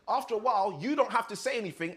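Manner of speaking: ordinary talk, nothing marked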